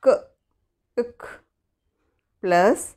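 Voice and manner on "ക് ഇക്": ക്, ഇക് said soft and clipped as a letter sound; teacher modelling the c sound. Shown as a demonstration. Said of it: "സിയുടെ pronunciation എങ്ങനെയാ?".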